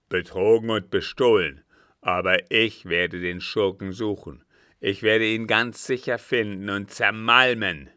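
loud, menacing captain's rage; furious vow. A narrator's reading. Captain swearing to crush the swindler, furious. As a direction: thunder out the threat. Cheated captain vows revenge.

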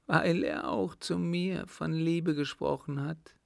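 high-pitched plaintive explanation; mildly tearful, high girl's voice; acted sobbing. A narrator's reading. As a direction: complain as a weeping girl. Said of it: "schluchzte die Gefragte".

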